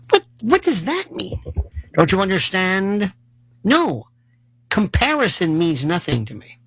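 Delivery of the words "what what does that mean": much higher, bewildered mock reply; a patient's high, confused protest; baffled. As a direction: squeak it out in disbelief. His baffled reply to the doctor.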